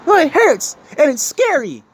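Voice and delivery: whiny mock objection. Speaker mocks people afraid to change, whiny and slow. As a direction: drag it out like a complaining child.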